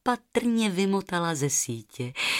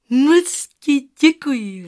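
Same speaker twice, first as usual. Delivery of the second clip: put-on high, loud, slow girlish thanks; delighted little girl voice, pitched up; grateful girl; 6 semitones higher, 8 dB louder, slower than usual. A narrator's reading. Girl thanks the goose for the feather, grateful.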